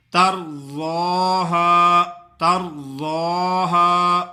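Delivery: تَرْضَاهَا slow, full heavy ض from its makhraj; heavy zwad. Shown as a demonstration. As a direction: chant تَرْضَاهَا with a thick ض. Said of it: "زواد کو اپنے مخرج سے ادا کرنا ہے".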